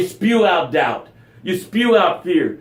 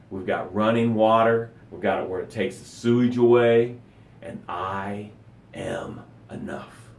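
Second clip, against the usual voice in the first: lower, softer, heartfelt and unhurried; sincere gratitude; 6 semitones lower, 5 dB quieter than usual. Shown as a demonstration. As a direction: show how a gratitude should sound. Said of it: "this is how it sounds".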